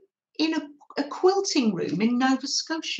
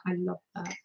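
repeated much softer, almost to herself; soft echo; 3 semitones lower, 9 dB quieter, much faster than usual. Shown as a demonstration. Soft echo to the stitchers, savouring the crocheting. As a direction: let the repeat fall away quietly. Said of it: "I, I love that".

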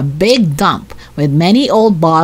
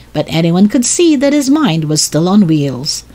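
ordinary delivery

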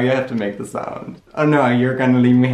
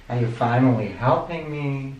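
usual speaking voice

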